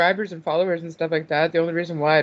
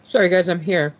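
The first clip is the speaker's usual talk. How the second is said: usual speaking voice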